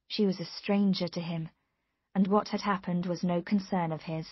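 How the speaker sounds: ordinary delivery, nothing marked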